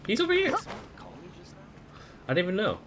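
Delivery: high, loud sudden cry of discovery; excited outburst. Real, not acted. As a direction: blurt it out, thrilled to spot him. Streamer spots character, surprised.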